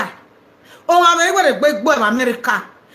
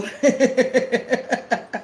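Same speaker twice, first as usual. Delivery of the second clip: quick staccato chuckles; amused, rippling laughter; pulsing laugh; 5 dB quieter than usual. Real, not acted. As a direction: laugh it out in short even bursts. Laughs after thanking caller.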